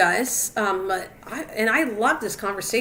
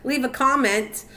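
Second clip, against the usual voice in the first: higher-pitched mock viewer; imitated viewer; 4 semitones higher than usual. Streamer teasing viewer who left, imitating him higher. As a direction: mimic a viewer hurrying back in, pitched up. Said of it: "and said".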